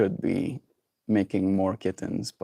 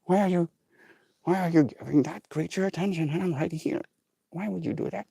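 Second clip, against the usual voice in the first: higher, softer whiny pet voice; jealous pet voice; 7 semitones higher, 5 dB quieter than usual. Pretend. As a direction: whine as the jealous dog. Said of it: "she's like".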